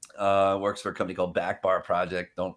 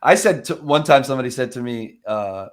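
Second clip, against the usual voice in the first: ordinary delivery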